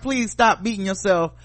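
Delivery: calm the crying agent down; mock reassuring. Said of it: "I'm like".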